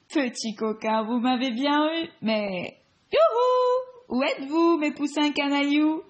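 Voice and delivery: high delighted granny calling; cheerful grandma voice. Acted. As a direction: call out to hidden grandchildren. Grandma hen calls her chicks.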